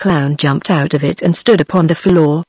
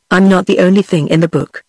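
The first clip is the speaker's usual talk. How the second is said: ordinary delivery, nothing marked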